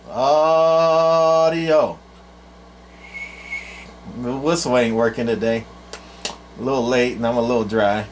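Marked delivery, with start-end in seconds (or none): 0.1-2.0 long held radio test call, higher; sing-song test call into a CB mic; drawn-out test call. Real, not acted. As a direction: stretch out each word into the mic. Keying mic for transmit test.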